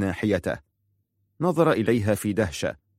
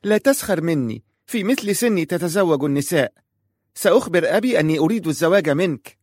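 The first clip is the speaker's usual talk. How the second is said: put-on high, sharp child's retort; a girl of eight snapping back, pitched high; indignant child voice, higher; sharp little girl; 8 semitones higher, 4 dB louder than usual. A narrator's reading. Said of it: "فقالت في حدة".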